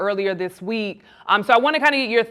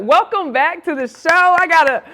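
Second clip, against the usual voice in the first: loud, high, building cheer; real jubilant host greeting; excited welcome; 8 semitones higher, 5 dB louder than usual. Real, not acted. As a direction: celebrate your guest's return, rising with each repeat. Host gleefully welcomes back her guest.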